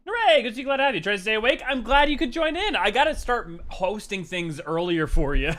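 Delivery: high, loud cheery welcome; bubbly hello to a viewer; excited greeting. Real, not acted. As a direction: greet them like a delighted host.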